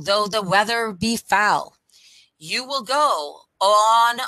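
plain reading voice

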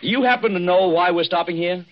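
calling out. Acted. Husband calls to Granger across deck.

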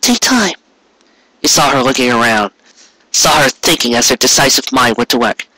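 plain reading voice

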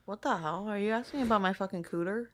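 ordinary talk

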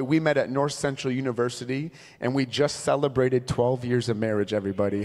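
ordinary delivery

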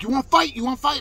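high, goading yell in imitation; loud, imitated aggressor. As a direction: mimic him picking a fight. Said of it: "this is me imitating him".

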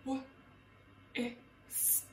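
"Wha-, isk" broken into separate sounds; sounded out. Shown as a demonstration. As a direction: split whisk into w, short i, s, k. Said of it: "The K sound is at the end".

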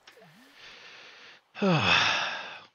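loud high theatrical sigh falling away; big dramatic sigh. Acted. Gamer sighing theatrically over sardines. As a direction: heave a huge exaggerated sigh.

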